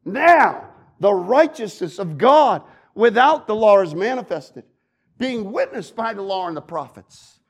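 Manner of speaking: loud, building preaching; forceful scripture reading. Acted. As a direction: thunder the verses at the congregation.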